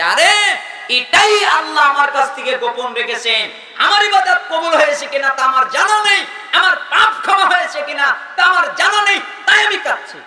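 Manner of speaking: high, loud acted anguish in preaching style; impassioned outcry. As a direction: hurl out each clause as a desperate explanation. Weeping man explains, acted full force.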